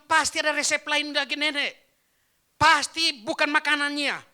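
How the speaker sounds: high, loud insistence; acted emphatic shouting; insistent shout. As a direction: press granny loudly for the secret. Pressing old woman for her secret.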